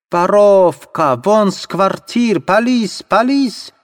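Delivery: put-on slow, very high German-accented shriek; wailing yell. Landlady screaming for police at Sonya, accented wail. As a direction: howl for the police. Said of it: "завопила Амаль Ивановна".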